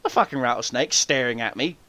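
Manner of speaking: usual speaking voice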